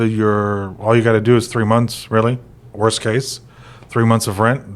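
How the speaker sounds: ordinary talk, nothing marked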